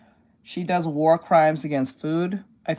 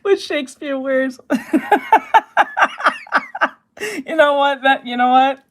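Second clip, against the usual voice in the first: high squealing laugh through the words; cracking up, can barely talk; 10 semitones higher, 6 dB louder than usual. Real, not acted. Cracking up at own joke.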